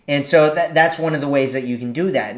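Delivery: ordinary talk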